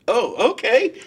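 surprised okay. Retold or imitated. Reacting to good news.

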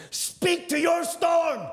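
high, loud and slow shout; shouted command. Acted. Preacher commanding the church to face the storm, shouting. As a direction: bellow it out slowly.

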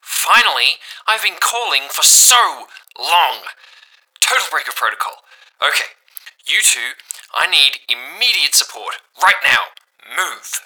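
loud, breathless radio voice; voice through static. Acted. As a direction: bark orders over crackling radio. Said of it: "slightly distorted and out of breath".

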